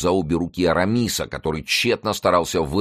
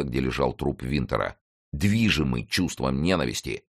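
usual speaking voice

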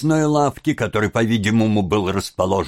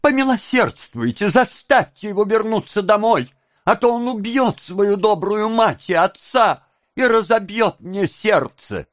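put-on high, lamenting young woman; pleading Nancy; 9 semitones higher than usual. A narrator's reading. Nancy begging bystanders to help, pleading. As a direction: beg the crowd in a high voice.

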